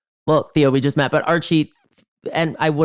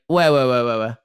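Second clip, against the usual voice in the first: string of "ouais" tossed off, trailing lower; imitated distracted brush-off; 6 semitones lower than usual. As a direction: mimic someone agreeing without listening. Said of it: "not even listening, being like".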